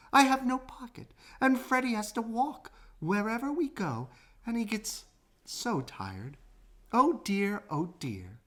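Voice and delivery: high and plaintive, fading at the end; a little kangaroo starting to cry; tearful. A narrator's reading. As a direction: complain through rising tears. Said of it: "she started to cry again".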